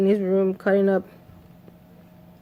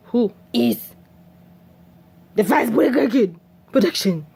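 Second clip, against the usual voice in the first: lightly hammed-up gangster demand; exaggerated tough-guy confrontation; mock gangster. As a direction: play at barging in all tough. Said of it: "he came in there all gangster like".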